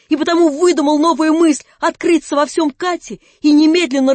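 high, loud, breathless confession; rapturous outburst. A narrator's reading. Alyosha confesses everything to Natasha, loud and rapturous. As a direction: pour it all out in a rush of feeling.

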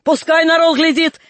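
defiant scream. A narrator's reading. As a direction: yell it so everyone hears. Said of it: "кричала Аксинья".

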